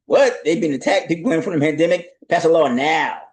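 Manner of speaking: mock urgency.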